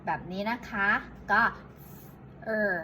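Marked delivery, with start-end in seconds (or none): 2.5-2.7 "เออ" as an isolated English R; English R sound. Shown as a demonstration. Teacher repeats for learners, "เออ" as English R. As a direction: hold the tongue raised on "เออ". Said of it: "ลิ้นมันจะลอยค้างอยู่ข้างบน".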